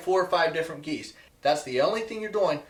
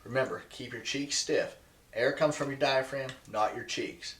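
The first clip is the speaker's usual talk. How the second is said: ordinary talk, nothing marked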